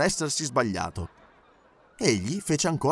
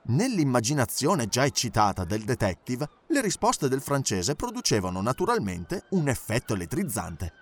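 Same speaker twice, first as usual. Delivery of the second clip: plain reading voice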